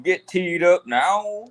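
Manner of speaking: ordinary talk, nothing marked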